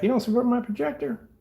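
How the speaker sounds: high, indignant complaint; mad customer. Retold or imitated. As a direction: mimic an annoyed customer. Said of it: "I get people get mad at me".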